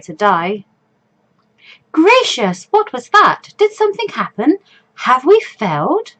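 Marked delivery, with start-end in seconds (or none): 1.9-6.1 startled gasp. Acted. Panicked player character voice.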